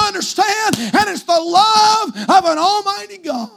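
high, loud shouted preaching; full-force preaching. Acted. Preacher shouting to the congregation, full force. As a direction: build to a roaring climax.

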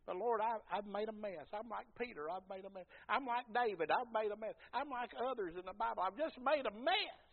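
high, loud pleading confession; pleading sinner. Acted. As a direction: beg the Lord, admitting the mess.

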